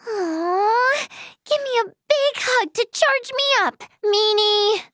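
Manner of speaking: high, pouty wheedling; cutesy pleading. Acted. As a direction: beg for a hug with a pout. Playfully begging for a hug.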